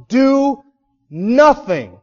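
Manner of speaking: "do nothing" punched loud, "do" pitched high; stress on do nothing. Shown as a demonstration. Preacher driving home the emphasis on do nothing. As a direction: hit "do" high, then land hard on "nothing". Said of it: "the emphasis is on".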